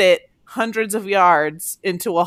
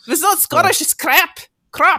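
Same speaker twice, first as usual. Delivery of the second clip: high, hammed-up Scottish accent; 9 semitones higher, 3 dB louder than usual. Shown as a demonstration. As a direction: put on a playful Scottish accent, pitched high. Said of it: "In a Scottish accent".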